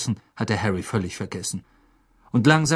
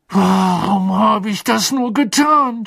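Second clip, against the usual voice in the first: higher, louder, slow anguished blurt; angry outburst; 10 semitones higher, 6 dB louder, slower than usual. Acted. Ron blaming himself to Harry, angry blurt. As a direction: spit it out at yourself. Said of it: "stieß er wütend hervor".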